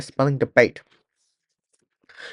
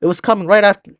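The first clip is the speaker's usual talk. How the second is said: louder and higher, panicky; frightened soldier; 4 semitones higher, 5 dB louder than usual. Acted. Panicked soldier telling the team about the bug, loud and shaken. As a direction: blurt it out, scared of the bug.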